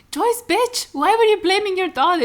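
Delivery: high, loud, outraged; outraged call-out. Real, not acted. Vlogger yells at Joyce for blaming Buffy, outraged. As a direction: shout at her in disbelief.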